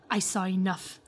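lightly imitated Italian accent; a hint of an Italian accent. Quoting a let-down suitor sitting down, mild Italian accent. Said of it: "stop doing the Italian accent".

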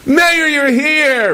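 high, drawn-out reunion cry; hammed-up delight at finding a lost friend; joyful greeting. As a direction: exclaim it slowly, voice soaring. Acted joyful reunion with an embrace.

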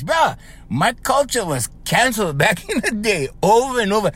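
ordinary talk

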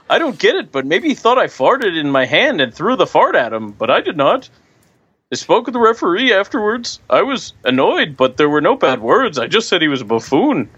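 high, mock Swedish accent; hammy attempt at a Swedish accent. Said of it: "I can't do a Swedish accent".

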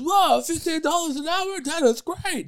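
very high, drawn-out squeal of delight; hammed-up delighted reply; mock amazed. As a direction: play her thrilled, way up high. Acted reply of the woman.

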